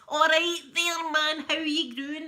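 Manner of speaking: put-on broad Glasgow street accent, slow and loud; hammed-up Glasgow hard man greeting; swaggering Glasgow ned hailing a mate. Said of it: "This is what Glasgow's like".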